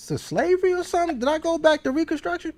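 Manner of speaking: incredulous. Retold or imitated. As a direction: pitch jumps way up in disbelief.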